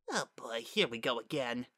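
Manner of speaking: soft, resigned aside; weary dread. A narrator's reading. Daughter braces for dad praising her sister, wearily. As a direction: grumble it quietly to yourself.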